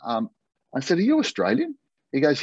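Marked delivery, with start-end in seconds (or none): 1.0-1.8 high, incredulous question; surprised question. Retold or imitated. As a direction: ask it in total disbelief. Surprised to hear an Aussie.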